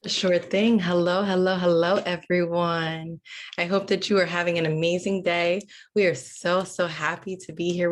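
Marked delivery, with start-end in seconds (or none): none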